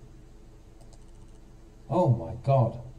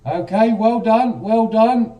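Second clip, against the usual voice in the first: much higher, louder "well done"; excited praise; 8 semitones higher, 8 dB louder than usual. Real, not acted. Teacher congratulating the student, loud and high. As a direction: burst out delighted at the student's work.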